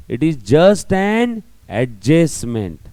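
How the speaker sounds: slow it right down to drive it home; slow emphatic point. Shown as a demonstration. Teacher driving home that depreciation is an adjustment, slow. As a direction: lay it down slowly, word by word.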